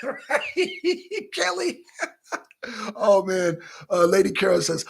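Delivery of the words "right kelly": laughing. Real, not acted. Laughing at chat comment.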